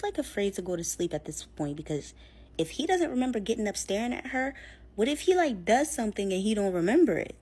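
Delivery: normal talking voice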